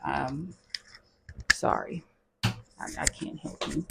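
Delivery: a hushed, teasing sorry to the viewers; whispered apology. Real, not acted. To viewers with short arms, a soft whispered apology.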